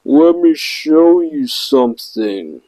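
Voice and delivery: put-on slow, slurred drunk words; drunk slurring. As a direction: drag and slur the words. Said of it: "slurring his words badly".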